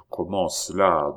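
usual speaking voice

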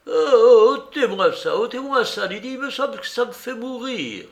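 high, loud cry of dread; horror. A narrator's reading. Beggar pushing away his will, high horrified voice. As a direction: wail and beg in panic. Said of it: "avec un mouvement d'horreur".

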